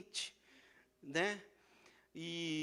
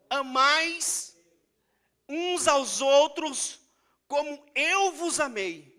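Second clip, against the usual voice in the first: slow scripture quote; 8 semitones higher, 12 dB louder, much slower than usual. Acted. Quoting Jesus, slow scripture.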